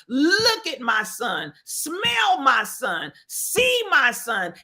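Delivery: slow, each command weighted; slow and deliberate, one verb at a time; slow insistent commands. Retold or imitated. Grieving mother to the town, slow insistent commands. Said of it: "Mamie was saying".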